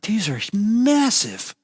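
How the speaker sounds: slow, high wonder; high, drawn-out awe; awestruck. Retold or imitated. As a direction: gasp it out in awe at the size. Awestruck in vast temple.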